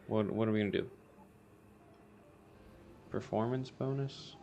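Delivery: usual speaking voice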